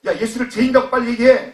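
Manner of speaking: high, loud, forceful demand; bully him into confessing, loudly; threatening. Acted. Pharisee pressuring the blind man to denounce Jesus, threatening shout. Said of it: "그렇게 협박을 했는데".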